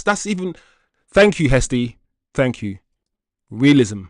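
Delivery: ordinary delivery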